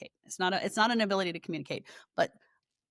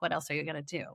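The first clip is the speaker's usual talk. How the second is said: usual speaking voice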